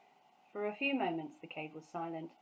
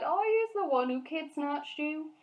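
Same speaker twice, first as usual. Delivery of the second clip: guilty lament; 8 semitones higher, 7 dB louder than usual. A narrator's reading. The giant crying in guilt.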